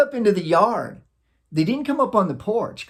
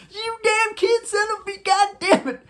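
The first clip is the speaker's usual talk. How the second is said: mock angry driver. Driver cursing the kids who ran out, hammed-up high angry voice. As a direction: play the cursing driver who nearly hit you, way up high. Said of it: "I remember what he sounds like".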